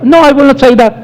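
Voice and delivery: ordinary talk, nothing marked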